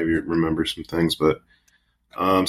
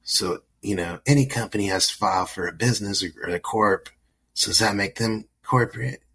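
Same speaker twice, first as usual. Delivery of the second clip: stoner; 3 semitones higher than usual. Retold or imitated. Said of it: "I love when people are like".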